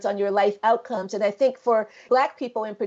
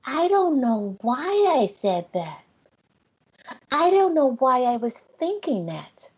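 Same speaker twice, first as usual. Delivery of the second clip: put-on sad little boy; 3 semitones higher than usual. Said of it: "with this really sad face".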